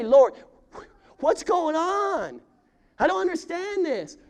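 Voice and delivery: high, loud, slow cry to God; pleading complaint. Acted. Preacher voicing a believer's raw plea to God, high and loud. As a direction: let the confusion burst out upward. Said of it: "this is an invitation to say".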